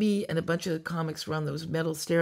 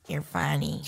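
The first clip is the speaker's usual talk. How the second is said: deep, lower voice for the club owner; low imitated Mitzi; 3 dB quieter, slower than usual. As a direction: drop low like an old club boss.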